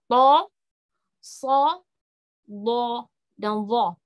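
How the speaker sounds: "to, so, do, dho" with heavy, rounded o vowels; full, thickened "to, so, do, dho"; thick letters. Shown as a demonstration. Teacher demonstrates the four itbaq letters, thick sound. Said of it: "suara tebalnya itu".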